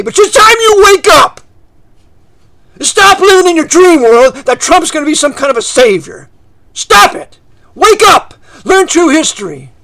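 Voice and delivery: full-force preacher's wake-up yell; high, loud, angry exhortation. Real, not acted. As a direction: shout to rouse a sleeping congregation. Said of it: "They don't like me yelling".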